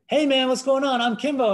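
surprising the producer with a big high-pitched hello; loud cheery greeting. Retold or imitated. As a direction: burst in with a big friendly hello. Greets him loudly with high five.

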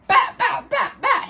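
very high, sharp imitated smacks; mock smack noises. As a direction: slap sounds from the mouth. Imitating the sound of the blows.

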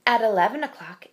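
plain reading voice

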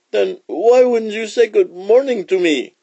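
high, accusing python voice; demanding snake. Acted. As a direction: demand an answer as an offended snake. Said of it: "demanded the snake".

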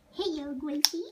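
ordinary talk

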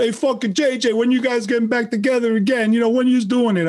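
lightly voiced New York guy pestering; pushy New York fan. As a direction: heckle him like a fan on the sidewalk. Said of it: "They're like".

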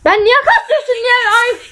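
high, strained squeal of complaint; exasperated high-pitched screech; shout turned scream. Real, not acted. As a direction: try to yell and let it shoot up into a scream. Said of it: "Bağırdım, çığlık oldu".

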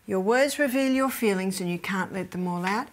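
ordinary talk, nothing marked

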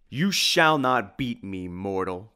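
slow, loud menacing vow; a vampire swearing revenge; Dracula's vow. Acted. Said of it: "cried the voice of Dracula".

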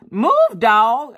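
high, loud order to a dog; exasperated command. Retold or imitated. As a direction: call it out to a stubborn dog.

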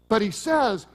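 ordinary talk

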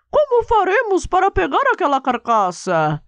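high cartoon dog voice; squeaky little dog asking. Dog character asks friends.

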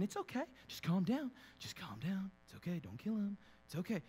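quiet, strained self-soothing mutter; forcing yourself calm. Retold or imitated. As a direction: talk yourself down under your breath. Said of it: "trying to tell yourself".

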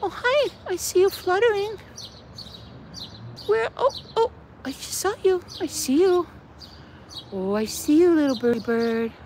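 soft, high birdie talk; real tender pet-talk, hushed and high; cooing to a bird. Real, not acted. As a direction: coo gently to a tiny bird. Talking to a little bird.

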